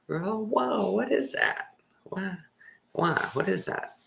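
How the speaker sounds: high, amazed mock voice looking at the sky; gawking onlooker. People spotting chemtrails, gawking high and amazed. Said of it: "they're going".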